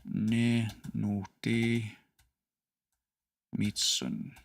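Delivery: slow, deliberate Cree phrase with every syllable spaced; Ruben's careful Cree. Retold or imitated. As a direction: carefully sound out 'Ne no te mitsun' one syllable at a time. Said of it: "And he said".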